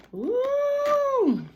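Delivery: high delighted whoop; excited whoop. Real, not acted. Reacting to the food.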